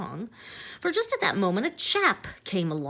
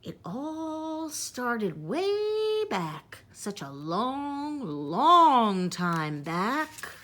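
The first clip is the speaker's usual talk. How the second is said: put-on slow, drawn-out storyteller's old man; old Onceler's voice; 5 semitones higher, much slower than usual. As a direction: stretch it out like an old hermit remembering. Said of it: "Now I'll tell you," he says".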